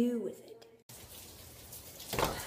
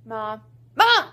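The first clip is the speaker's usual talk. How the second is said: calling out; 2 semitones lower, 6 dB louder than usual. Acted. Calling out to mother.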